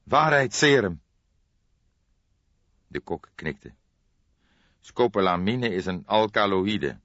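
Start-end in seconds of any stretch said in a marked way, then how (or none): 0.0-1.0 panting. A narrator's reading. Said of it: "Appie Keizer hijgde".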